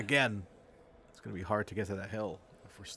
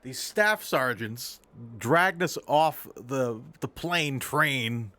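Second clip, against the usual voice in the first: slow; 2 semitones higher, 5 dB louder, much slower than usual. Acted. Urgent improv scene report.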